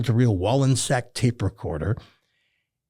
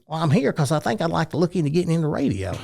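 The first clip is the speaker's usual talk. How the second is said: put-on hillbilly accent; 4 semitones higher, faster than usual. Student introducing himself in speaking class, hillbilly accent. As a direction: imitate your younger hillbilly self. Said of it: "you talk like this".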